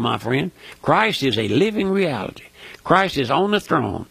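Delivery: ordinary talk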